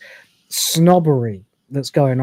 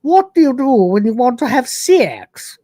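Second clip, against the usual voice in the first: higher and louder regal voice; fuller regal hostess; 8 semitones higher, 4 dB louder than usual. Retold or imitated. Hostess asking Rimmer again, higher and louder regal voice. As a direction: say it grander, more arch. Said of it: "Or s-, actually".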